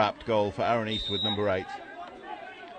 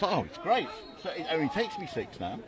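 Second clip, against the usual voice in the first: higher-pitched, softly imitated happy driver; retold delight; 4 semitones higher, 7 dB quieter than usual. A Manchester driver happy his trip got shorter, retold delight. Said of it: "he was so happy".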